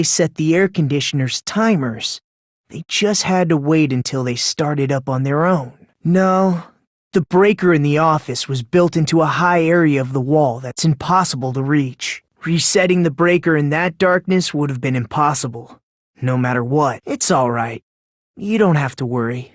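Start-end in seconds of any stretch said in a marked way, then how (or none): none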